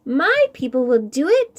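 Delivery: proud tiny ant promising help; ant voice, firm. Acted. Ant promising Koi its people will help, proudly. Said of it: "said the ant".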